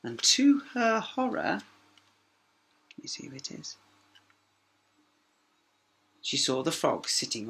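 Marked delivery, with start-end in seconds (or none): 2.9-3.8 whispered aside; whispered narrator aside. Real, not acted. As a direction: breathe it softly to yourself.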